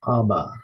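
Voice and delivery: Abba, both syllables clear and even; teacher demonstrating Abba; Greek vocabulary word. Shown as a demonstration. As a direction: model Abba for students to repeat. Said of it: "Next word is".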